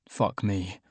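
usual speaking voice

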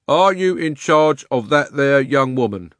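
loud, slow official questioning; pompous policeman. Acted. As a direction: question the suspect. Policeman voiced.

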